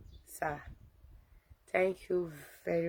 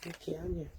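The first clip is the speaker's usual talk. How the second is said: ordinary talk, nothing marked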